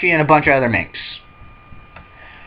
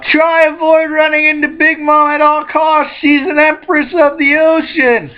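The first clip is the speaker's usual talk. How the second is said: warning shout; 11 semitones higher than usual. Retold or imitated. Usopp voiced warning.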